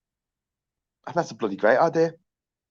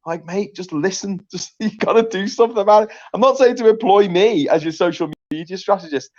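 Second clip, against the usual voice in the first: high, louder exasperated plea; mock pleading to friend; 7 semitones higher, 4 dB louder than usual. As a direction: beg him to just listen.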